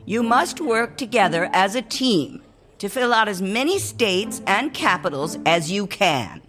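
high, piercing teacher voice; screeching teacher. Acted. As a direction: squawk orders at the class. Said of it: "Missus Kim screeched".

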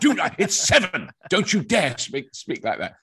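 high, loud mock scolding mother; laughing mock scolding of a mother. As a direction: snap at the child like a strict posh mother. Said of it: "She hated it".

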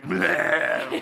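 short mock growl. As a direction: growl it out in one burst. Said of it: "literally growled at me".

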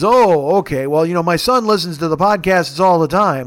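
ordinary talk, nothing marked

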